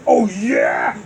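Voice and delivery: loud, scornful sneer. Acted. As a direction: sneer it at him. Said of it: "Bowser sneered".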